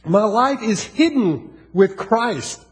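slow, weighty reading; reading the verse slowly to the church; slow scripture quote. Acted. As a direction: let each word land slowly. Quoting Colossians.

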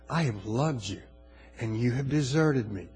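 low, softened solemn voice; God gently reproaching his people; quiet grave reproach. Retold or imitated. God's grieved words to the people.

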